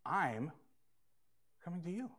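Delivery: soft, gentle promise; the gospel's answer, said softly and tenderly. Acted. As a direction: let it land quietly and kindly.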